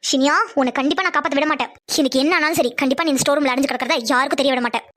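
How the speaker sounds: low-pitched, private threat; menacing inner vow. Acted. Girl secretly vowing nobody will find trapped Iniya, low menace. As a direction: vow it to yourself, low and determined.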